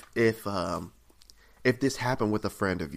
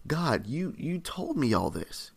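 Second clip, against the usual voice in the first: higher-pitched, bewildered protest; exasperated complaint to God; 4 semitones higher than usual. Acted. Preacher voices Jeremiah questioning God, exasperated and tricked. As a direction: plead with God for an explanation, voice leaping up. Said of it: "This is how Jeremiah feels".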